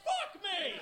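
a hammed-up stage flourish; showy magician. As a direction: throw it out like a cocky magician after a trick. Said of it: "I would be like".

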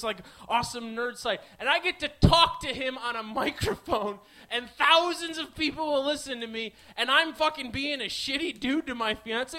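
ordinary talk, nothing marked

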